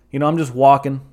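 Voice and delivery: usual speaking voice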